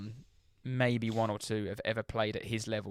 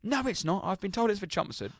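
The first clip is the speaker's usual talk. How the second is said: much higher, indignant mock retort; stubborn passenger; 9 semitones higher than usual. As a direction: refuse to back down. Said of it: "And he goes".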